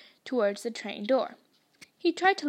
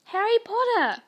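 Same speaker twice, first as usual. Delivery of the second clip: chorused; 9 semitones higher, 3 dB louder than usual. A narrator's reading. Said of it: "chorused the twins".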